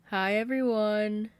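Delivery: high, loud and slow, sugary sing-song greeting; sweet sing-songy. Acted. Caitlin on the coffee table greeting the party, sweet sing-song. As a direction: sing it out sweetly to the whole room. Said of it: "she proclaims in the sweet, sing-songy way".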